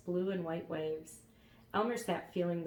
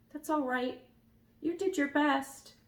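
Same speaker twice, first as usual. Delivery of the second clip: sobbing; 8 semitones higher, 4 dB louder than usual. A narrator's reading. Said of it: "sobbed Elmer".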